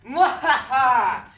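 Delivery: slow, loud villain cackle; evil laugh. Acted. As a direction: mad scientist cackle.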